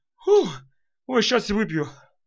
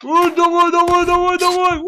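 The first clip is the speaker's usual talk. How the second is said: loud urging; 10 semitones higher, 7 dB louder than usual. Real, not acted. Urging character on.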